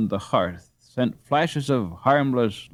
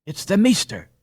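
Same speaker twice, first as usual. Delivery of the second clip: high, loud and eager; delighted cry; 11 semitones higher, 4 dB louder than usual. A narrator's reading. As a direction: call out the good news gladly. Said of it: "cried the delighted dame".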